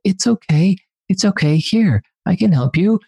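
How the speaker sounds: put-on high, gentle little-kid comforting; imitating his toddler. Father imitating his toddler comforting a crying kid, high childlike voice. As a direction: soothe a fallen kid like a sweet toddler would. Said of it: "And he's like".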